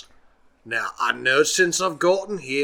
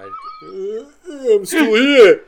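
owl voice; 10 semitones higher, 9 dB louder, much slower than usual. Acted. Owl speaks while carrying him.

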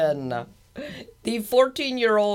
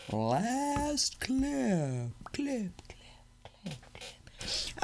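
fake echo; 6 semitones higher, 10 dB quieter, much slower than usual. Shown as a demonstration. Host faking an echo effect on the word clip, fading. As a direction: repeat it, softer and softer, fading to a whisper. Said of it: "That was really a weak kinda echo".

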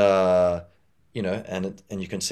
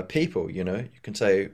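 normal talking voice

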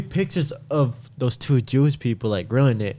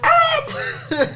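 shrieking the name. Retold or imitated. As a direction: squeal the name at full pitch. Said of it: "I was like".

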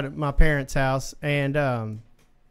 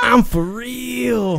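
stretched out very slowly, pitched up; drawn-out quote; 5 semitones higher, much slower than usual. Retold or imitated. Storyteller imitating his dreaming buddy, drawn out. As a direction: draw each word right out.

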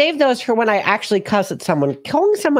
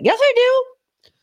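squeaky high retort; slower than usual. Acted. Retorting to Twitter critics.

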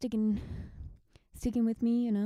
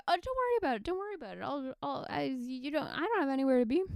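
much higher, eager to please; high reassuring voice; 5 semitones higher, much faster than usual. Retold or imitated. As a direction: pitch it up and reassure. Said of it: "And I'm like".

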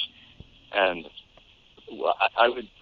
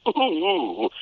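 cartoon character's ooh; 10 semitones higher than usual. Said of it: "he was always in these".

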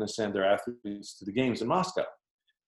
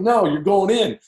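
coach's firm order; 7 semitones higher, 6 dB louder than usual. Retold or imitated. Coach sends him into game.

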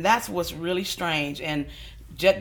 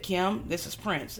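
lightly voiced sultry phone greeting; 8 dB quieter, slower than usual. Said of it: "seductive and sexy".

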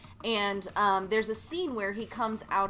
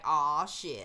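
lower, mock regretful curse; dismayed groan; 6 semitones lower than usual. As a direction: sink low as if cringing at old footage. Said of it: "be like".

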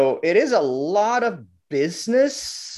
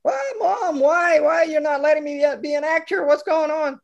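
put-on high, pleading child's voice; boy begging mom to let him act; whining kid; 11 semitones higher than usual. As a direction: whine at mom like a hurt kid. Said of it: "clears throat".

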